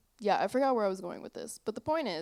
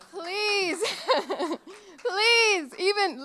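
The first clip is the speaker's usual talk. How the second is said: laughing; 9 semitones higher, 8 dB louder, much slower than usual. Real, not acted. Laughing protest at mom remark.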